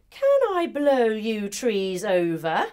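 usual speaking voice

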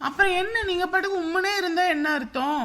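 high, loud, fed-up complaint; wife scolding her sulking husband, exasperated. Acted. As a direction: nag him for sulking.

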